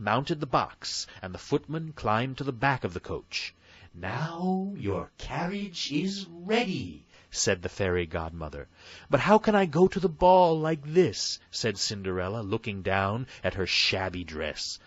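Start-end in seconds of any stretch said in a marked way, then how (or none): 3.9-7.0 high, slow, kindly fairy voice; fairy godmother. A narrator's reading. Fairy godmother giving Cinderella orders, high and slow. 9.1-11.4 put-on high, loud, girlish plea; a young girl crying out her worry; Cinderella. A narrator's reading. Cinderella pleading with her godmother, high and loud.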